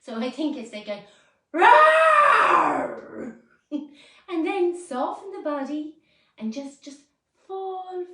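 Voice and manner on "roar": huge roaring shout, high and very loud; lion's roar. Shown as a demonstration. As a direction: let out a full lion's roar. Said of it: "I hope I know how the lion roars now".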